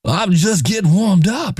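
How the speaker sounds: high, put-on gangster movie voice; a deliberately terrible movie-star impression; horrible Al Pacino imitation. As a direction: ham up a bad Al Pacino growl. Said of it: "That's a horrible Al Pacino imitation".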